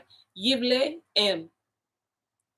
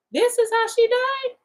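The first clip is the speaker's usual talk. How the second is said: much higher, stunned question; shocked disbelief; 13 semitones higher, 3 dB louder than usual. Real, not acted. Reader shocked by Jezebel's gruesome death, high stunned question. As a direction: gasp it out in disbelief.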